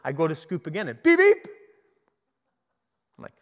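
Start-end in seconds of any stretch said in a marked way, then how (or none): 1.0-1.5 high, loud horn beeps; car horn imitation. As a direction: honk sharply like a little car horn. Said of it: "It's like a car horn".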